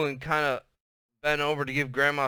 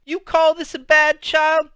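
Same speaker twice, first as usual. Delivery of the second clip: shouting; 11 semitones higher, 6 dB louder than usual. Acted. Grandma shouting down the phone to Lona, loud and high. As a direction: holler it so they hold the phone away. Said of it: "she shouted".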